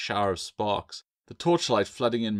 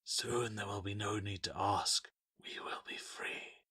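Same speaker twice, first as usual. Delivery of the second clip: soft, soothing creature voice fading to a whisper; 9 dB quieter than usual. A narrator's reading. Tree spirit soothing the carver into obedience, soft whisper. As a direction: lull her gently, almost breathless. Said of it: "came the soothing tones".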